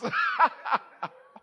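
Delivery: loud, high burst of laughter; hearty laugh. Real, not acted. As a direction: burst out laughing at your own joke. Laughs at own joke.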